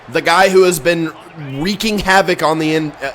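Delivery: normal talking voice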